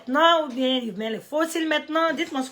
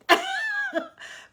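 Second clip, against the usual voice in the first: high burst of laughter. Real, not acted. Laughs after "je vous invite" pun.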